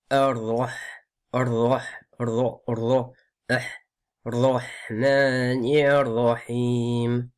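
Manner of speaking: "Ar-Rah" with a strong, full heavy R; careful recitation, heavy "Rah", long final vowels. Shown as a demonstration. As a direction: drill "Ar-Rah", then stretch "Ar-Rahmanir Rahim". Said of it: "la distinction entre les voyelles, entre les lettres fortes".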